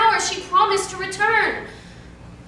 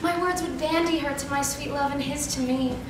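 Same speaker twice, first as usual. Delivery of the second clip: ordinary delivery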